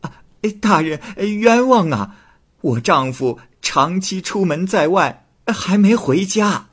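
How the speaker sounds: high, moaning woman crying injustice; wailing plea. Acted. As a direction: cry out that she's wronged. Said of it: "王氏供称". (王氏哀声喊冤)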